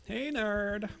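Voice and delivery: high, loud teasing holler; playful call-out. Acted. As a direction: call it out high and drawn-out to a pal. Game characters call out greetings.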